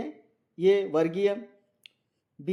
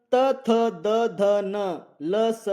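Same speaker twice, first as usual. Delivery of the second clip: slow drawn-out recitation; 3 semitones higher, 5 dB louder, much slower than usual. Shown as a demonstration. Teacher reciting letters slowly.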